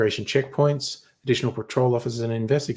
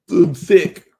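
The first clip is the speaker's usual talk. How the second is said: higher, louder put-on sick voice; mock sick announcement; 7 semitones higher, 10 dB louder than usual. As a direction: announce it like a hammed-up invalid. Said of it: "just say".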